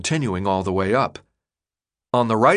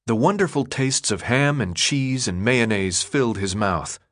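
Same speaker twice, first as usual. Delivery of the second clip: plain reading voice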